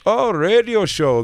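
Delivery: slow, high mock Indian accent. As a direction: imitate an Indian cabbie taking it in. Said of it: "with an accent like that".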